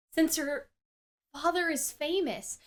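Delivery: ordinary talk, nothing marked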